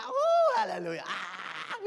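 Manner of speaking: short high whoop; sample of a joyful shout; demo shout. Shown as a demonstration. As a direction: yelp it out high. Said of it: "Pag sumisigaw ka".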